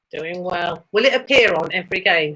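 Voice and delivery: ordinary talk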